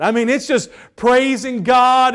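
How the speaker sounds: usual speaking voice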